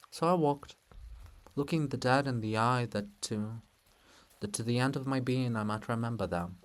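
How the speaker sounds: quiet grave reflective reading; solemn narration. A narrator's reading. Narrator mourns the dead in quiet solemn narration.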